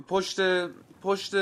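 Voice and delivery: very high, loud, cut short; urgent calling out. A narrator's reading. Man calling his destination to a taxi, urgent. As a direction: blurt it at the driver, pitch way up.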